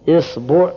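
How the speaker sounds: إصبع as "isbu'", i first, u after ba; kasra then damma. Shown as a demonstration. Teacher listing the kasra set, إصبع as "isbu'". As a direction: say إصبع with opening i and second vowel u. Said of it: "كسر الهمزة".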